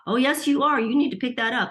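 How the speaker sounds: high, loud and insistent; an inner voice that won't take no. Retold or imitated. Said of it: "this other voice that's like".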